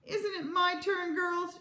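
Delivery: high, slow pleading whine; whiny, wailing question; tearful appeal. A narrator's reading. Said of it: "she cried".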